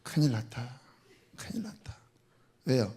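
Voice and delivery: from a low murmur into an unvoiced whisper; inner worry, fading to whisper. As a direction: think it in alarm, barely aloud. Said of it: "그러면 속으로".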